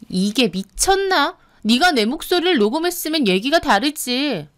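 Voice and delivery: outraged and threatening; indignant. Acted. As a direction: round on them in anger. Sister-in-law angry at being recorded.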